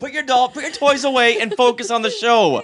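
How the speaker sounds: high-pitched, playful telling-off; exasperated scolding. Pretend. Host ordering his wife back to the show, high exasperated scolding. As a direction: scold like a fed-up parent.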